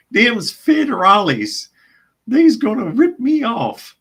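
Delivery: high, loud hammed-up backwoods drawl; comic Southern hillbilly accent; exaggerated hillbilly accent. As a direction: play the moonshiner guarding his still. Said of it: "old Pappy still is in danger".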